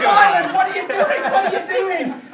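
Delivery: high, loud disbelieving yell, asked twice; appalled yell. Retold or imitated. Shouting at Wyland through the door, high and appalled. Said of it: "I said".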